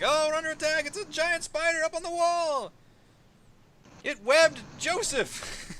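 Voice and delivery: high frantic yell; guards yelling. Acted. Guards raising the alarm about the spider, high panicked yelling. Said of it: "you clearly hear people yelling".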